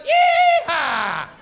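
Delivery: high, loud cowboy whoop; yelled yee-haw. Shown as a demonstration. Host showing one audience member his part, loud high whoop. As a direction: whoop it out at full voice.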